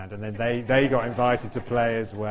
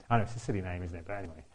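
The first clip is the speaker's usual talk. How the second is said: usual speaking voice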